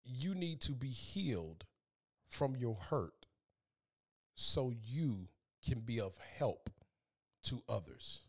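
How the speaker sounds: hushed, earnest plea with weighty pauses; heartfelt appeal. Real, not acted. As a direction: speak gently straight to the listener's heart. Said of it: "can I speak to your heart".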